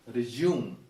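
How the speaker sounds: verb 'resume' as 'ri-JOOM'; model pronunciation. Shown as a demonstration. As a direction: say 'resume' with a 'j' glide, stressed at the end. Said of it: "it goes like this".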